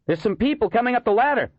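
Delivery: high-pitched alarmed report; urgent warning. Acted. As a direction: call out a warning to the others. Policeman warns of approaching figures.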